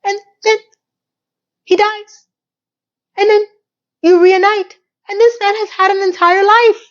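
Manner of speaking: high, mounting incredulity; exasperated rant. Real, not acted. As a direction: pile it on in disbelief. Ranting about the storyline.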